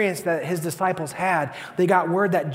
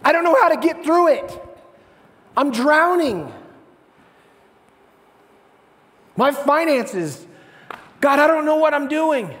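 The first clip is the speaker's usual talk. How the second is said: raised, pleading voice of someone drowning in trouble; overwhelmed plea; 7 semitones higher, 5 dB louder than usual. Acted. Struggling believer cries out to God, raised anxious plea. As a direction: pour out the panic over bills and marriage. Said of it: "and it says".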